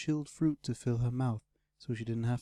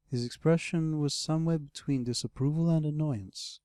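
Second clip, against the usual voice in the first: plain reading voice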